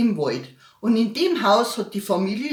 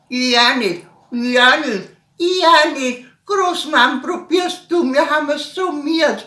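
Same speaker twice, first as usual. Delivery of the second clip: whiny family chorus; 5 semitones higher, 5 dB louder than usual. Retold or imitated.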